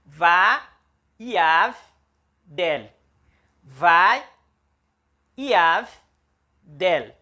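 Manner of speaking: va, yav, del each said apart and loud; syllables counted out. Shown as a demonstration. Teacher drilling the syllables va, yav, del. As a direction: split vaiav into va, yav and del. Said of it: "Ela tem uma, duas, três sílabas".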